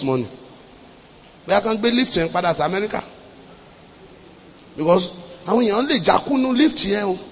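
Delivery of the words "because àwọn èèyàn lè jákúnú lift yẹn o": ordinary delivery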